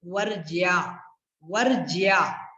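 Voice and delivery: वर्ज्या with a clear "jyaa" ending; corrected pronunciation. Shown as a demonstration. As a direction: slowly sound the y-glide in वर्ज्या. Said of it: "ज्या बोलना है". (वर्ज्या में 'ज्या' साफ़ और धीरे)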